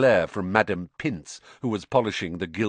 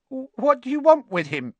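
frightened, high-pitched and louder; quavering; 5 dB louder than usual. A narrator's reading. Ernie confronted by Harry, quavering. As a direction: squeak it out in fear. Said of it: "said Ernie in a quavering voice".

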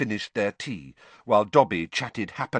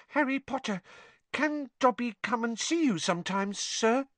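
elf shyly asking a favour; timid squeaky elf; tentative elf. A narrator's reading. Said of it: "Dobby asked tentatively".

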